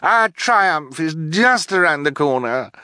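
high-pitched triumphant cry; shrieky villainess exultation; shrieking. A narrator's reading. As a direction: crow it high, in villainous glee. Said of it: "Esme shrieked".

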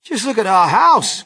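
high, loud protest; distressed cry. A narrator's reading. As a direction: wail over the damage. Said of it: "cried poor Mister Bucket".

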